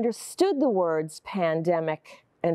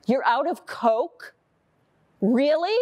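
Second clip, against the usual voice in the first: incredulous; 7 semitones higher, slower than usual. Acted. Shocked customer questions the cashier.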